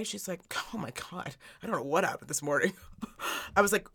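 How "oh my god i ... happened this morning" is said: much softer, small voice; sheepish. Retold or imitated. Mom imitates daughter calling her afterwards, sheepish and quiet. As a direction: imitate a hushed, embarrassed confession on a call.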